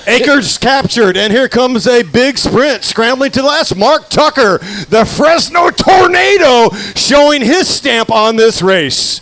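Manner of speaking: building play-by-play that peaks in a shout; excited race call. Acted. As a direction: call the sprint finish like a hype announcer.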